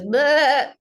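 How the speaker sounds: a playful little bleat like a sheep. Retold or imitated. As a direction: bleat it out, light and silly. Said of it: "He goes".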